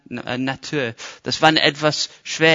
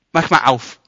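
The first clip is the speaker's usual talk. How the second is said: louder order to the sea; commanding sea; 6 dB louder than usual. Pretend. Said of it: "ich sag mal".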